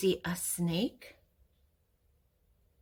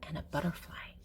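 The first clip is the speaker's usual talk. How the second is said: put-on soft hushed whisper to a child; 5 semitones lower, 8 dB quieter, faster than usual. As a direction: whisper it like a secret. Pointing out pictures softly.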